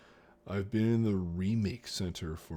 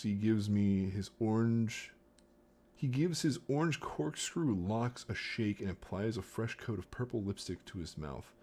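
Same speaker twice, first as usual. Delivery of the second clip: plain reading voice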